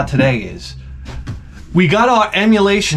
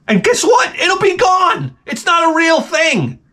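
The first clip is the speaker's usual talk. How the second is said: much higher shouted rant; high shouted mock rant; 13 semitones higher than usual. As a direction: shriek the rant like a crank. Ranting rant.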